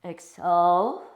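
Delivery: loud, slow exhale cue with an airy breath sound; long loud exhale cue. Shown as a demonstration. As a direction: push the breath out with the class.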